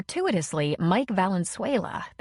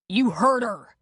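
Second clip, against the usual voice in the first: louder mock yell; 4 semitones higher, 5 dB louder, slower than usual. A narrator's reading. Connor yelling at Dylan in the street, moderate yell. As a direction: throw it at him with a yell. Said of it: "Connor yelled at Dylan".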